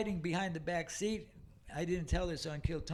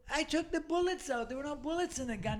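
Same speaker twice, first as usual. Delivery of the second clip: heated protest; 8 semitones higher than usual. Retold or imitated. Arguing with father.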